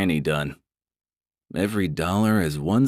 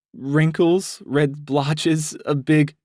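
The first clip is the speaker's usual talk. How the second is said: higher, pleading woman's voice; mildly upset; 9 semitones higher, much slower than usual. Acted. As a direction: defend your choice a little tearfully. Brandy listing her flaws.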